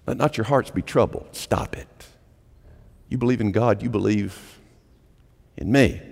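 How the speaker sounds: soft, near-whispered command; hushed plea. Acted. Jesus hushing the anxious disciples, soft and intimate. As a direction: lean in and quiet the room.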